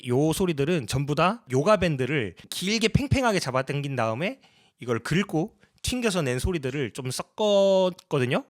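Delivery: usual speaking voice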